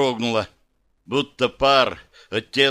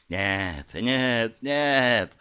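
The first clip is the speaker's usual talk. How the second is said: hissing darkness; much slower than usual. Acted. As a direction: hiss it like jealous shadow. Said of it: "зашипела тьма". (шипение тьмы)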